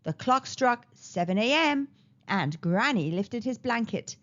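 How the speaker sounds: ordinary delivery, nothing marked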